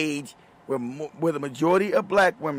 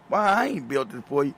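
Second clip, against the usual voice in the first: ordinary talk, nothing marked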